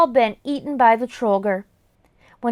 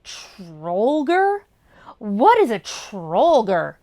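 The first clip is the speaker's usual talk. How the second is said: slow, lower, eerie goblin voice; 3 semitones lower, much slower than usual. Acted. Goblin questioning the boy, slow and eerie. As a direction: draw it out, spooky and strange. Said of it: "asked with an eerie voice".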